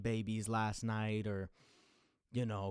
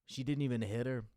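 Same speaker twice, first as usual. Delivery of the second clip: ordinary talk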